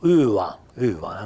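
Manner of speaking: uva con la U piemontese; pronuncia piemontese. Shown as a demonstration. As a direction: ripeti uva alla piemontese. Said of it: "questa U". (uva piemontese ripetuta)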